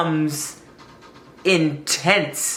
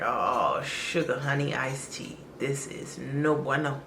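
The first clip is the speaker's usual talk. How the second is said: softer mock-swear under the breath; quiet dismay; 4 semitones lower, 8 dB quieter than usual. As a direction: mutter the fake curse to yourself. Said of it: "And I was like".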